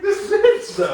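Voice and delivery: high, loud, laughing indignation; mock outrage. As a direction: exclaim in hammed-up disbelief. Retelling outrage at a classmate.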